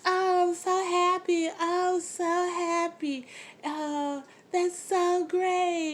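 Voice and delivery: hammed-up high, slow gushing; mock overjoyed. Judy hears her son is coming home, mock overjoyed and slow. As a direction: imitate Judy's hammed-up joy, high and drawn out. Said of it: "And Judy's like".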